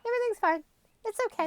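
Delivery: fake-cheerful denial. Host mocks someone stuffing their upset, in a falsely cheerful high voice. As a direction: chirp it like you're pretending all is fine.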